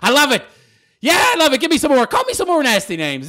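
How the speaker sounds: loud, high, relishing taunt; gleeful goading. Acted. Host daring trolls to call him names, gleeful. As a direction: egg them on with loud delight. Gleefully invites more insults.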